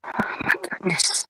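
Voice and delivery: breathless whisper under the breath. Real, not acted. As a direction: whisper it to yourself, exasperated. Exasperated aside under breath.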